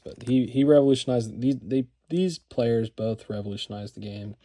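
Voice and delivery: tripping and restarting on words; stuttering a little. Real, not acted. Card collector explaining how players changed the game, stuttering a little. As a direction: stumble over the openings, then get the sentence out. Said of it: "I'm stuttering a little bit".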